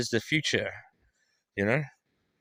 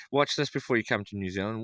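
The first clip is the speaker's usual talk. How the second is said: usual speaking voice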